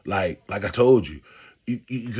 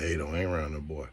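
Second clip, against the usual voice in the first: a hint of a grandmother, lower and softer, warning; hushed grandma warning; 5 semitones lower, 8 dB quieter than usual. Grandmother warning grandkid about Zeke, hushed. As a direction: murmur it like grandma cautioning you.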